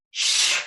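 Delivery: a loud unvoiced shh on the out-breath; long sh breath out. Shown as a demonstration. Teacher demonstrating the sh breath to the class, a loud unvoiced shh. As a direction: push the breath out through the teeth as shh. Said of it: "This is called the sh breath".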